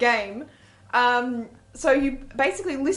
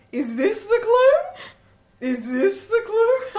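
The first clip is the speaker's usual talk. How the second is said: high, slow puzzled guest voice; hammed-up eager party guest; high mock guest voice; 9 semitones higher, 4 dB louder, much slower than usual. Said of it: "people kept coming to me going".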